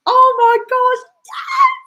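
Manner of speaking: high, loud delighted squeal of oh my gosh, yes; excited squeal. Real, not acted. Reading a viewer's spy joke, a real delighted squeal. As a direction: burst out thrilled and laughing.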